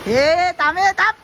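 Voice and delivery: high shout of ए, थांब; calling after friends. Real, not acted. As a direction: yell after them to wait. Calling friends who ran off. (पळालेल्या मित्रांना थांबायला हाक)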